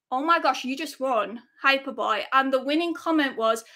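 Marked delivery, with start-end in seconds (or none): none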